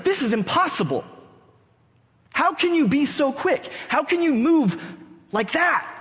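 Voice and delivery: high-pitched frustrated outburst, acted out; imitating an angry, baffled fighter; angry, confused. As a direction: demand an answer in exasperated disbelief. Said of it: "he's angry".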